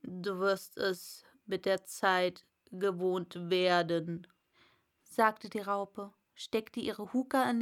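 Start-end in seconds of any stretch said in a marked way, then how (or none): 0.0-4.3 low, slow caterpillar voice. Acted. Caterpillar lecturing Alice, low and slow. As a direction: reassure her lazily.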